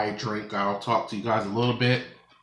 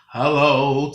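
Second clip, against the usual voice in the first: excited greeting; 6 semitones higher, 10 dB louder than usual. Acted. Greets another YouTuber excitedly.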